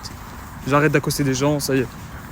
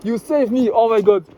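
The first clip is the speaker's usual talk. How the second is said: higher-pitched amazed gratitude, with laughter; overjoyed relief; 6 semitones higher, slower than usual. Real, not acted. Traveller thanking the stranger who offers a place, overjoyed. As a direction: burst out in real, giddy relief.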